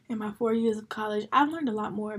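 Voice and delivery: normal talking voice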